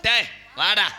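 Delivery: loud summons; calling out. Acted. Storyteller ordering his partner to come, shouting. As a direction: call him over with force.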